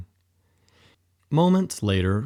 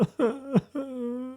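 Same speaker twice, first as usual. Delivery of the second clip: high, strained sobbing cry; soft high wail; anguished sob; 13 semitones higher, 7 dB quieter than usual. Acted. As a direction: let the grief break out. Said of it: "Olaf said while sobbing profusely".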